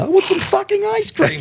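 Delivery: put-on very high squeaky toddler whine; a writer recalling the toddler's whine; toddler demand. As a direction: squeal it like a tiny child. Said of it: "all I can hear is".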